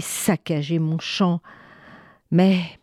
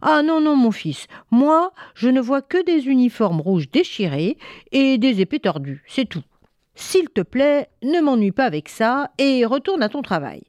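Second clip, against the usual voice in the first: higher-pitched, exasperated mother; scolding mother sending him back to work; dismissive mother; 7 semitones higher than usual. A narrator's reading. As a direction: wave away the son's enthusiasm. Mother dismisses son's admiration.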